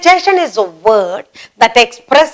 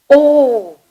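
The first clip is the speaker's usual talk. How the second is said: surprised oh; 4 semitones higher than usual. Shown as a demonstration. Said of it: "Again for surprise".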